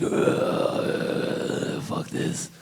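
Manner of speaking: unvoiced, fed-up mutter; whispered fed-up waitress; waitress groan. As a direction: breathe it out like a waitress who hates her shift. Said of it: "she walked up just like".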